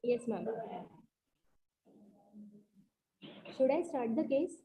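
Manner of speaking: soft voice, hard to hear; too soft for the call; barely audible. Real, not acted. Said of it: "but voice is still low".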